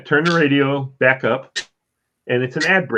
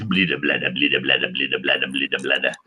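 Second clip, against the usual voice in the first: engine noise imitation; 10 semitones lower than usual.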